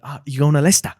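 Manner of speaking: a loud, bright-pitched London accent. Shown as a demonstration. Imitating a Londoner in a comedian's impression. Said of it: "that's how he would do that impression".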